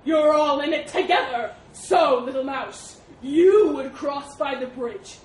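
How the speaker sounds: slow, loud, booming accusation; accusing elephant. Acted. Elephant accusing the mouse of plotting, loud and slow. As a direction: boom it like an indignant elephant.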